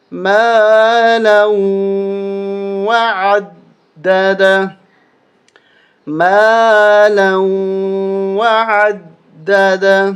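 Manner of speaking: مالا وعددا slow and firm; slow recitation. Shown as a demonstration. Teacher recites slowly for students to copy. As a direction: recite slowly, stressing each word. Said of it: "دوبارہ سنیں".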